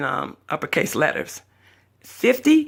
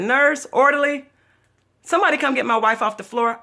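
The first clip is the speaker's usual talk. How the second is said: retold call for a nurse, pitched up; calling for help; 8 semitones higher than usual. Husband summons staff after his wife faints, calling out.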